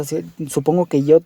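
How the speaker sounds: ordinary delivery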